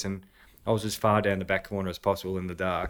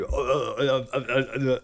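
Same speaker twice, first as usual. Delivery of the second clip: flustered stammer; 3 semitones higher, slower than usual. Retold or imitated. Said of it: "Flustered was the best word".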